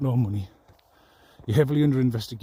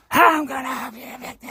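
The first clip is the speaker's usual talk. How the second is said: mock shouted threat; 12 semitones higher, 4 dB louder than usual. Shouting threat back at her.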